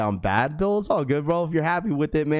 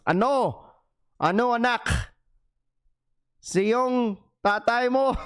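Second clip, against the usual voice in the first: high, slow mock Filipino mom; Tagalog-speaking mom, raised high and slow; Filipino parent voice; 8 semitones higher, slower than usual. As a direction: play a nagging Filipino mother.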